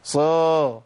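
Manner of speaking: صا as the mistake, lips rounded; wrong emphatic. Shown as a demonstration. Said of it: "ما اقولش صر".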